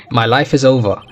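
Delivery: ordinary talk, nothing marked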